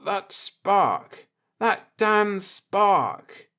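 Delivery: a bound man cursing his own blunder; groaning. Acted. Bound Akers realizing what wrecked him, groaning. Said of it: "Akers groaned".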